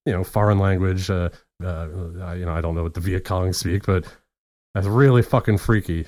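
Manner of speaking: normal talking voice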